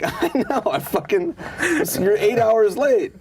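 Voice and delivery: mock scold between laughs; laughing exasperation. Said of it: "And I'm like".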